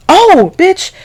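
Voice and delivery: sudden high squeal of surprise; shocked outburst. Real, not acted. Vlogger remembering the night, sudden high shocked outburst. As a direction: blurt it out like it just hit you. Sudden memory mid-story.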